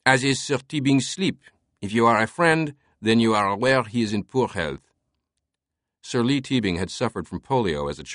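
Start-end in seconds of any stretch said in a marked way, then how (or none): 0.1-4.9 higher, irritated French voice; French-accented servant, irritated. A narrator's reading. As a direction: answer curtly in a French accent. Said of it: "an irritated French accent spoke".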